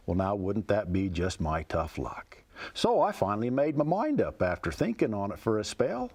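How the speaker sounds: poem recited with a folksy lilt; cowboy poetry. Acted. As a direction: tell it like a rhyming cowboy yarn. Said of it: "It goes like this".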